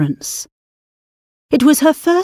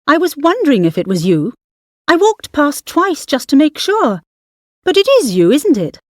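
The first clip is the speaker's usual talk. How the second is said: excited chatter; 6 semitones higher than usual. Acted. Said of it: "the woman said loudly".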